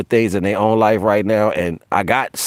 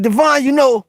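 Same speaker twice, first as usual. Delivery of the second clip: much higher pleading call; high plaintive call. Retold or imitated. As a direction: call up to Divine like a whining child. Calling on Divine when mad.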